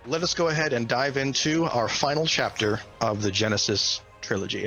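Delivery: ordinary delivery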